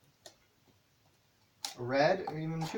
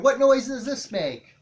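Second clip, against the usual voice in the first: higher, louder, slow, sing-song question; teacher to toddler; 8 semitones higher, 8 dB louder, slower than usual. Shown as a demonstration. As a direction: ask the child brightly, slowly. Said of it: "teaching with puppet".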